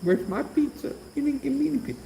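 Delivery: high-pitched whiny puppy voice; voicing the puppy. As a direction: beg like a pouty little dog. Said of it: "He's like".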